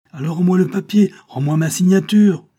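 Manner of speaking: high, loud shouted demand; angry demand. Acted. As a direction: demand it back at full voice. Enraged count demands papers back.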